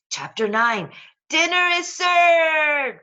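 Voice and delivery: high, slow, loud announcement; grand slow announcer shout; announcing shout. A narrator's reading. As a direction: belt out the chapter title. Chapter title shouted out.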